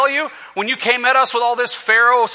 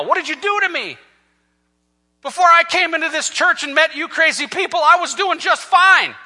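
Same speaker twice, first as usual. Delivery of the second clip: high-pitched, aggrieved protest; doubting complaint; 7 semitones higher than usual. Acted. Doubting convert blames the church for their troubles, complaining. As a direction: protest it high, stretching the last words. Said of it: "They're doubting now".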